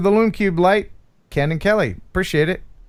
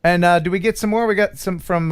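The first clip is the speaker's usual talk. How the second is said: ordinary talk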